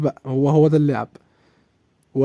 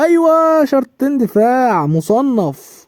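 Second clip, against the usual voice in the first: excited shout; 8 semitones higher, 6 dB louder, much slower than usual. Real, not acted. Celebrating a play in game.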